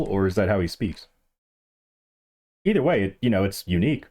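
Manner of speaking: ordinary delivery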